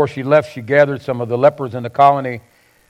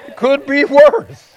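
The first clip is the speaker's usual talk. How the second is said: slow, higher, chuckling as "worse" lands; slow, laughing stress on worse; 12 semitones higher, 5 dB louder, slower than usual. Shown as a demonstration. Pastor repeats his counseling advice to the congregation, slow and laughing. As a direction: drag it out and land "worse" hard with a laugh. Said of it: "I've done a lot of counseling".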